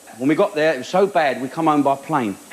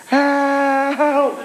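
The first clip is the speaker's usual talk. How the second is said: high yelped call for help; mock shout for help; 8 semitones higher than usual. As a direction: yell it like drowning. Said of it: "He who shouts the loudest lives".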